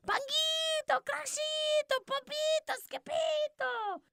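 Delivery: very high, far-off calling of names; high calling voices. Acted. The girls racing to the kitty boy's room, high calling. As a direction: call out each name way up high. Family calls names in chorus.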